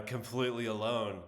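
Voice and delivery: ordinary talk, nothing marked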